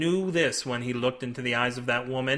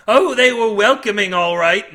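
loud, raised sailor voice; intense old-salt storyteller; 5 semitones higher, 9 dB louder than usual. A narrator's reading. Milby continuing his warning to Cade, fervent. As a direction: lean hard on the bitter memory.

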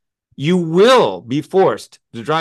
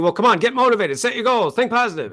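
pushy motivational speaker; 6 semitones higher than usual. Retold or imitated. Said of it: "motivational speaker comes up and says".

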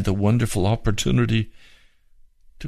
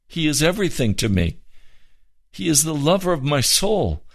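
rising fervour; 7 semitones higher than usual. Real, not acted. Preacher building in fervour.

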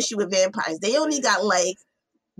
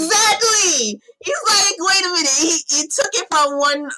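high excited yelling; excited shout; 8 semitones higher, 6 dB louder than usual. Real, not acted. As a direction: shout it, thrilled. Excited agreement, cry and shout.

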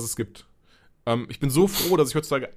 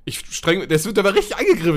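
ordinary delivery

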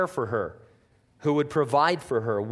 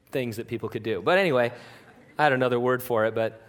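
usual speaking voice

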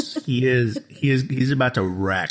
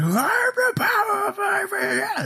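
very high, garbled villain shriek; mock scream. Imitating the cliffhanger cut into end credits, high mock scream. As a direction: squeal the threat as a strangled imitation. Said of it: "Screaming like".

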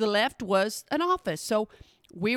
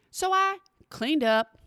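normal talking voice

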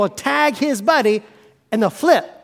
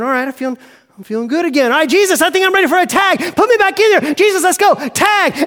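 fast, higher and louder, raring to go; eager pumped-up call; 7 semitones higher, 3 dB louder, faster than usual. Acted. Believer eager to jump back in, calling Jesus loudly. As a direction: psych yourself back up and shout to be tagged in. Acted eager return to the ring.

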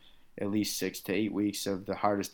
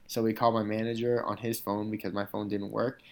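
ordinary talk

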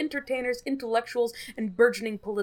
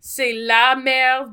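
loud mock French; 2 semitones higher, 8 dB louder, much slower than usual.

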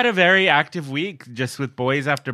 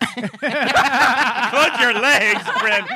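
laughing; 8 semitones higher, much slower than usual. Real, not acted. Friend dancing sexy, mock alarm.

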